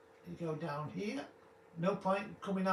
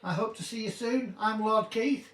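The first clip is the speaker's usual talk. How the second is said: louder, slow theatrical farewell; grand sign-off; 3 semitones higher, 5 dB louder than usual. Acted. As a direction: announce the goodbye like a host.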